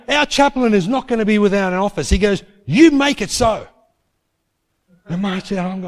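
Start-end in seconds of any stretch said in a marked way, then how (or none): 0.1-3.7 higher and louder, firm final order; angry insistence. Retold or imitated. Principal insisting to the accountant, raised angry voice. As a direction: lay down the law. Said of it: "he was so angry".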